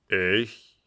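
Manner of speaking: mildly taken aback; astonished. A narrator's reading. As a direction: react in astonishment to an accusation. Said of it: "fragte Richemont erstaunt".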